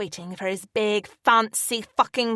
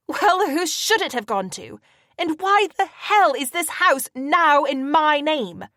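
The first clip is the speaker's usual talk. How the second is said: angry demand; 7 semitones higher, 6 dB louder than usual. A narrator's reading. Angry confrontation.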